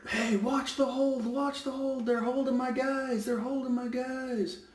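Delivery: high, nagging imitation of a coach; a pushy coach, impersonated high; mock coach yelling. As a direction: whine it like a coach barking at the ref. Referee mimicking a coach shouting.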